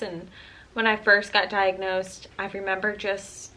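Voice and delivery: ordinary talk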